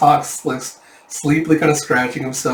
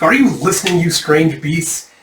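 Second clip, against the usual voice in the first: exasperated scolding; 4 semitones higher, 5 dB louder than usual. Acted. Yun scolding his strange friends, exasperated and loud. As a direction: demand their attention, fed up.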